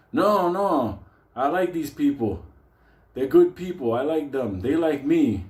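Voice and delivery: lightly voiced old man; fond insistence. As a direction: reassure them earnestly. [name] insisting.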